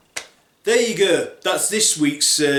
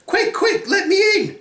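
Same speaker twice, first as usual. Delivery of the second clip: squeaky, much higher pig voice, urgent; panicked little pig. Acted. Little pig begging his brother to let him in, high squeak. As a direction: beg at the door like a frightened piglet. Pig fleeing wolf begs entry.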